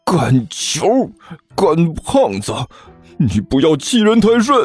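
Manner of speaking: seething, climbing in pitch; through gritted teeth. Acted. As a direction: grind out each name in anger. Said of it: "傅晨磨着牙说道". (磨着牙愤恨地说)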